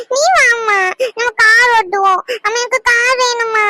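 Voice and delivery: put-on a little boy begging his mother; whining child. Child begs mother for car. (அம்மாவிடம் கெஞ்சும் குழந்தை)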